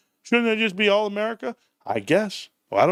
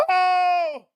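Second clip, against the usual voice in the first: excited yelp; 10 semitones higher than usual. Real, not acted. Vlogger excited over card count, high yelp. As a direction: let out a thrilled oh. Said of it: "I get excited".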